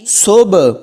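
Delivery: صبح slowly, with an echoing bounce on the final b; qalqalah. Shown as a demonstration. Teacher showing how seen joins ba, bouncing qalqalah. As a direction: bounce the closing b of صبح open. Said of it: "سین کو با کے ساتھ ملائیں گے تو کلکلا کریں".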